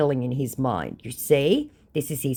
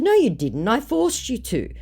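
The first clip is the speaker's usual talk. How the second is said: raised, emphatic retort; firm contradiction; 10 semitones higher than usual. Retold or imitated. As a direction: correct him sharply, voice lifting high. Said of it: "I said".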